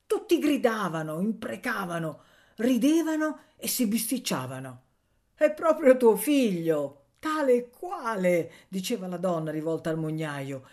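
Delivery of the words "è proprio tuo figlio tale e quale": high, loud, drawn-out taunt. A narrator's reading. Woman taunting the miller about a son, mocking. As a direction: needle him, stretching the words slowly.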